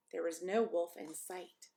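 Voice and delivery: ordinary delivery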